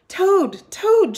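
high, loud calling out for Toad; shouting. A narrator's reading. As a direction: shout his name up at the house. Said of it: "shouted Frog".

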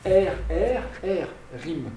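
R, R, R said slowly as separate rolled sounds, then rime; sounding out R. Shown as a demonstration. Teacher sounding out R for the class, isolated rolled sounds. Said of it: "Vous avez trois fois le son R".

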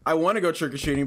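ordinary talk

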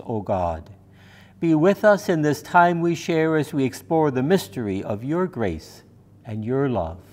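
ordinary delivery

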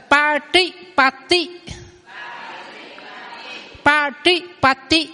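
slow Patipati with two different t sounds; Pali model word. Shown as a demonstration. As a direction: drill Patipati slowly. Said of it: "hai chữ ti này đọc khác nhau".

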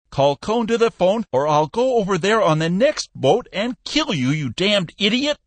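high, loud furious threat; shrieking. A narrator's reading. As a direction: shriek it down the phone in a rage. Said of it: "shrieked Peck".

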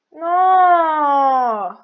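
long, loud drawn-out "no"; dismayed cry. Real, not acted. Player reacting to a game setback, dismayed cry.

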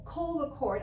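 ordinary talk, nothing marked

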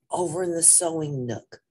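usual speaking voice

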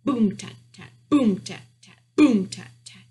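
loud booms, lighter staccato tats; slow drumbeat. A narrator's reading. Mouse beats the council drum, booming then tapping. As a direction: voice a drum, one long beat then two short. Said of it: "began to beat the drum slowly".

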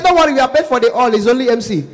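high, loud bargaining voice; haggling caller. Acted. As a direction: play an excitable client haggling by phone. Anambra caller haggles over the fee.

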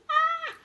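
short, high loud scream; startled high-pitched yelp; piercing scream. A narrator's reading. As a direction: shriek in sudden fright. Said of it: "she gave a piercing scream".

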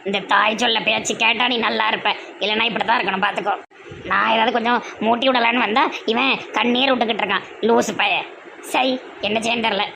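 put-on a cunning old mother coaxing her son; scheming mother.

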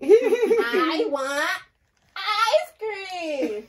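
put-on loud laughing toddler whine; mock kid begging for ice cream; imitating toddler. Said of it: "This is what he says twenty-four seven".

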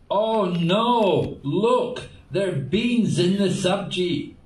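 high, loud cry of horrified discovery; alarmed yell. Retold or imitated. Devotee alerts others to beans in the sabji, alarmed yell. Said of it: "suddenly somebody yelled out".

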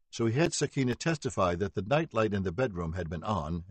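ordinary delivery

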